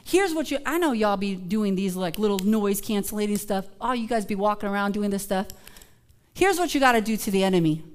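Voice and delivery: ordinary delivery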